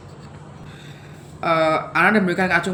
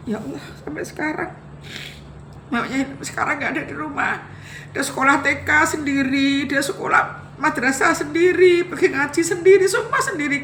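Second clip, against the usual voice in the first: real grief, voice pitched higher and straining; fighting back tears on each phrase; voice breaking; 6 semitones higher than usual. Real, not acted. Mother remembering the children she left behind, voice breaking.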